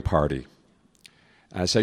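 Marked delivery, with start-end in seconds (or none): none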